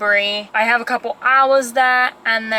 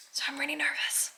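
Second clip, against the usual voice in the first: a breathy confession, barely voiced; nervous whisper; 4 semitones higher, 12 dB quieter, faster than usual. Real, not acted. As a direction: whisper it like a secret you're half embarrassed by. Confessing nerves before first week.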